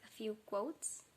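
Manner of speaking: ordinary talk, nothing marked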